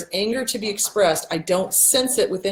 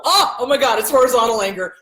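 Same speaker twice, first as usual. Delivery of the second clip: relieved recall; 4 semitones higher, 5 dB louder than usual. Real, not acted. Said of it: "That took me a week".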